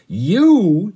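loud, high, drawn-out bellow; angry bellow. A narrator's reading. Captain suddenly notices the boy stowaway, angry bellow. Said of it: "he bellowed, and his plump nose was red with anger".

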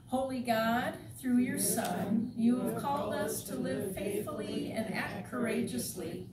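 steady, measured public prayer; formal prayer. Acted. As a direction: lead the congregation in prayer, deliberately. Leads the opening prayer.

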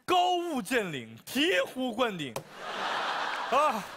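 slow grand praise. Acted. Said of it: "简直就是".

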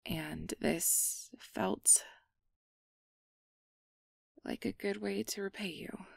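slow, shy whisper. Acted. Shy thanks to the guest.

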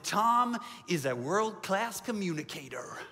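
slow, drawn-out mock American accent. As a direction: put on an exaggerated, unhurried American accent. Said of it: "How's my American accent there?".

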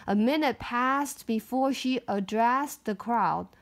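ordinary delivery, nothing marked

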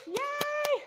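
high squeaky little cheer; excited yay. Real, not acted. Coach cheers at the end.

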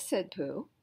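plain reading voice

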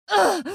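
short, high pained yelp. Acted. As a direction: cry out sharply when hit. Oyun karakteri vuruluyor. (tiz acı nidası)

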